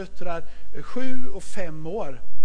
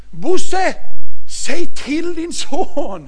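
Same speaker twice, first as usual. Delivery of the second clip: much higher, louder, slow plea; exasperated wife; 10 semitones higher, 7 dB louder, much slower than usual. Retold or imitated. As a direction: call on grandpa for help, exasperated. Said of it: "Helena vänder sig till".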